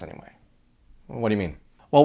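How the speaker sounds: ordinary talk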